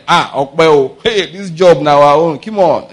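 acted cheer of someone sure of the job; excited greeting. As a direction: hail your man on the panel, loud and gleeful. Greeting an insider at panel, acted.

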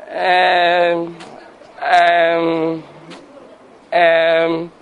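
long, level "ummm"; drawn-out stalling hum. Retold or imitated. Children caught asking daddy after mommy, long level ums.

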